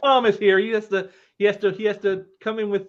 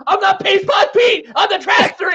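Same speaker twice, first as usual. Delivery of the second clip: villain shout; 11 semitones higher, 8 dB louder than usual. Retold or imitated. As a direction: bellow it like a cartoon villain announcing himself. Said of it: "and he goes".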